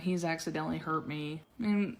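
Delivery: usual speaking voice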